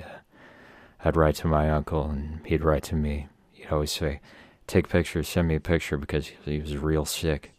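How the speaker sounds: hushed mock-sincere stage banter; folk singer intro. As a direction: pretend to introduce a sad song. Parodies folk singer song intro.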